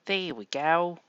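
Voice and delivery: bad Australian impression, broad vowels; Australian accent. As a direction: lay on a terrible Aussie accent. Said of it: "My terrible, uh, uh, Australian accent".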